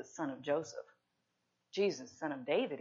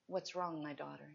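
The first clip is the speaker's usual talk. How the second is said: tender Jesus; 2 semitones lower, 8 dB quieter than usual. Acted. Jesus speaks gently to weeping Mary.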